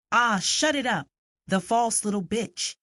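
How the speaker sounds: put-on loud, high angry snarl at a crying child; angry keeper. Gamekeeper yelling at the crying girl, angry and loud. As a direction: bark it at the sobbing girl. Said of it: "came the man's angry voice".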